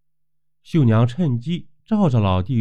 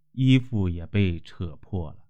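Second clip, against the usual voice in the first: plain reading voice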